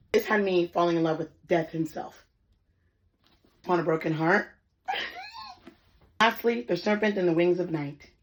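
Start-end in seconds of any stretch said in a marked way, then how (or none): none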